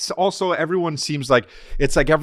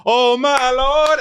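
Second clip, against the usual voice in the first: high, slow, louder Oh my Lord; hammed-up gospel swell; 10 semitones higher, 4 dB louder, much slower than usual. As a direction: swell it like the choir. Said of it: "And they get louder again".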